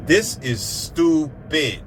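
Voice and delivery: THIS IS STUPID drawn out slowly, word by word; emphatic. Real, not acted. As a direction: stretch "This is stupid" with heavy stress on every word. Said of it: "I can't put emphasis on it enough".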